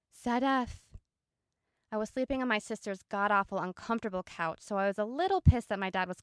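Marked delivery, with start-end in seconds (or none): 0.1-0.9 louder urgent half-whispered call; whisper yelling. Retold or imitated. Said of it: "something of a whisper yell".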